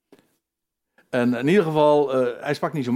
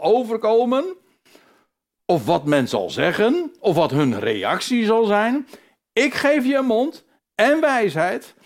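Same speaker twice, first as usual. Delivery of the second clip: louder, higher, driving preacher's voice as God; preaching at full force; 9 semitones higher, 5 dB louder than usual. Acted. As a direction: thunder out God's challenge to the doubter. (vol vuur preken als God die Mozes terechtwijst)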